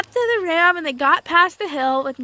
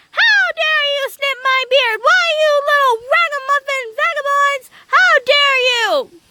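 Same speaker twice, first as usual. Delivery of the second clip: high, louder indignant scolding; angry little man; 11 semitones higher, 3 dB louder than usual. A narrator's reading. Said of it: "that little man was angry".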